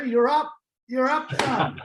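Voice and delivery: high, loud teasing call. Acted.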